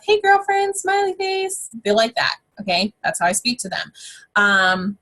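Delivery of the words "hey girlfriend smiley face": very high, put-on bubbly greeting; gushy girlfriend voice. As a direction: sing out a mock girly hello. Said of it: "blues like that stuff".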